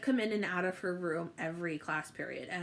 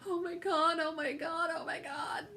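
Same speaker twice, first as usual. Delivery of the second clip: high, breathless oh my God repeated; panicked excitement; 8 semitones higher than usual. Retold or imitated. Student retelling her nervous excitement at meeting the teacher.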